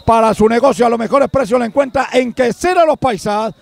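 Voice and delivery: fast, low commercial read. Acted. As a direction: rattle off the sponsor plug. Sponsor ad read.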